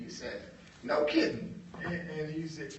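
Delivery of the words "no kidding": mock surprise. John feigning amazement.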